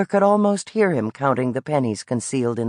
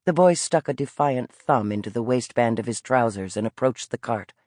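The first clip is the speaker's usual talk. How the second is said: ordinary delivery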